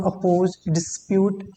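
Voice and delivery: ordinary talk, nothing marked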